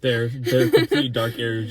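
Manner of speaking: imitating her, laughing loudly; laughing imitation. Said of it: "just like that".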